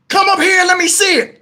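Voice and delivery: high shouted reply; imitating partner upstairs. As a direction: call back as the partner upstairs. Voices partner shouting back.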